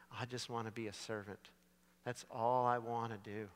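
the broken son deciding to go home to his father; broken, humbled. Acted. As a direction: speak it like a man with nothing left. Broken son's quiet resolve.

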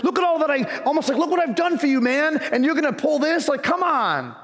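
indignant self-pity. Retold or imitated. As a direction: sulk like you're owed thanks. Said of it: "that was kind of the mindset of".

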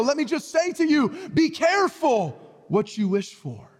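fiery sermon voice; preaching. Acted. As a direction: drive the warning home from the pulpit. Preaching climax.

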